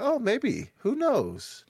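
light high musing voice; high mock musing. Imitated own past thought.

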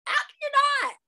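very high-pitched mock disbelief; incredulous reply. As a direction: squeak the question as if you can't believe it. Her own retold incredulous reply.

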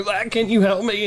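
high, desperate plea; pleading call. Retold or imitated. Knees buckling, asking Zach for help, high pleading call.

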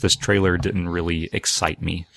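usual speaking voice

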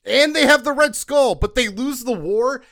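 loud, high comic disbelief; escalating outraged questioning; incredulous. Real, not acted. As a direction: demand an answer in exasperated astonishment. Incredulous rant about the plot.

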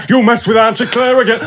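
laughing through a mock old man voice. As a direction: put on a rough old bloke threatening. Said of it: "made her sound like an old man".

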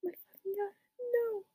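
high but soft mock scream; mild mock scream. A narrator's reading. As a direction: cry out in pain without the volume. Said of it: "He started to scream".

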